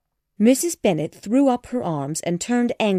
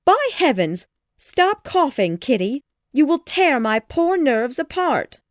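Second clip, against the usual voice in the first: high, loud scolding at Kitty; yelling; 8 semitones higher, 4 dB louder than usual. A narrator's reading. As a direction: shriek at the coughing girl. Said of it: "she began to yell".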